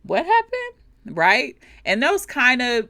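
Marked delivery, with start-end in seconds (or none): 0.0-1.2 puzzled, with a big high rise on happened; puzzled huh. Acted. Pausing after a mistake, a slow puzzled what happened. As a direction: wonder aloud, slow and baffled. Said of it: "we pause and we're like".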